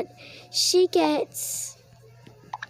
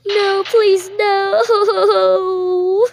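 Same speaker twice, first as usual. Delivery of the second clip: high, louder pretend wail; mock crying plea; 4 semitones higher, 4 dB louder, much slower than usual. Girl being arrested cries out in mock despair. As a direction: wail no as you're hauled away.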